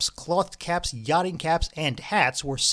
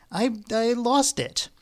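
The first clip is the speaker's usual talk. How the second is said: faltering confession; 8 semitones higher, slower than usual. A narrator's reading. Guilty confession.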